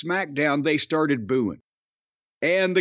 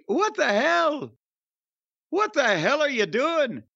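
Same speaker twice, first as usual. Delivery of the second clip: mock outrage; 6 semitones higher than usual. Drew's angry promo quote.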